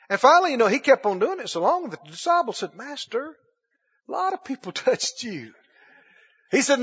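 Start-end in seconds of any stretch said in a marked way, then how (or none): 2.7-5.5 softly, carefully point it out to the master; a gentle, tentative objection; hesitant correction. Acted. Disciples to Jesus in the crowd, hesitant correction.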